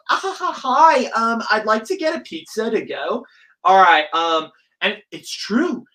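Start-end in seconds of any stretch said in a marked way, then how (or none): 0.1-3.3 chirpy over-polite phone-order voice, pitched way up. Acted.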